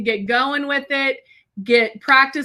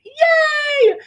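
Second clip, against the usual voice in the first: real excited yay; excited squeal. Real, not acted. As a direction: squeal with delight. Excited livestream greeting.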